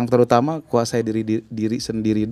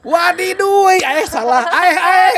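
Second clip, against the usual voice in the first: squeaky, much higher put-on comic voice; Ogi's catchphrase; 5 dB louder, slower than usual. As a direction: mimic Ogi's catchphrase in a high cartoonish squeal. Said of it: "itu punya Ogi".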